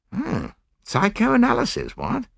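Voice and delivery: put-on high-pitched young officer, taken aback; alarmed. A narrator's reading. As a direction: pitch jumps up in mild alarm. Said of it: "with visible alarm".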